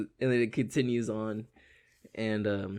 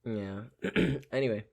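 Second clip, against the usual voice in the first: ordinary talk, nothing marked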